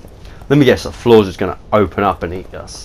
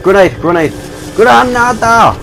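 grenade warning yell; 10 semitones higher, 3 dB louder than usual. Pretend.

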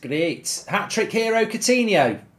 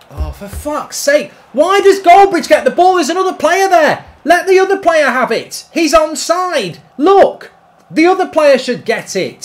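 higher, louder angry rant at the game; frustrated shout; 8 semitones higher, 7 dB louder than usual. Real, not acted. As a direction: yell at the screen in real frustration. Raging at the game AI.

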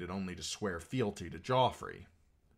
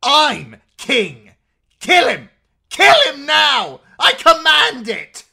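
high, screaming royal tantrum; enraged command; 23 dB louder, slower than usual. A narrator's reading. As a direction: scream orders in a fury. Enraged boy king orders a killing.

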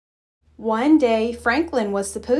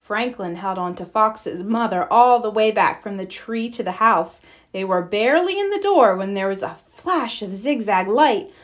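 plain reading voice

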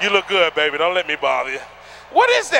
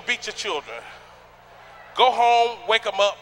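ordinary delivery

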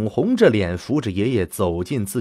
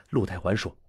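plain reading voice

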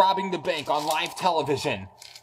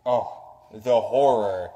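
mock dramatic; 6 semitones lower, 5 dB louder, much slower than usual. Mock dramatic reporter line.

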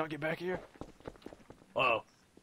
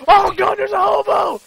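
high loud yell of alarm; panicked shout; 13 semitones higher, 10 dB louder than usual. Real, not acted. Gamer screams as a hobo attacks them.

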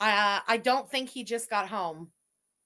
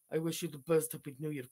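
low, creepy mimicry of his greeting; mock-sinister low crypt keeper voice; 7 semitones lower, 6 dB quieter, faster than usual. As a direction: imitate his fake-smile wishes, sinking quieter. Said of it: "You look like the fucking crypt keeper".

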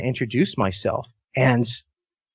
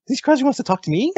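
much higher, fast incredulous question; disbelieving fanboy; 12 semitones higher, faster than usual. Retold or imitated. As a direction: rattle it off in giddy disbelief. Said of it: "I'm like".

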